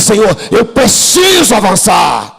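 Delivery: slow, full-voiced shout; preaching shout. Real, not acted. Preacher leading the church in a loud slow cry. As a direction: cry it out to the Lord.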